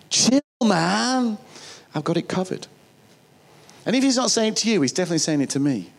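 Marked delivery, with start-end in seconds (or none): 0.1-2.7 lightly voiced chilled-out God, "chill" pitched up; laid-back God. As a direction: reassure them like a relaxed mate. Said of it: "what I think God's trying to say".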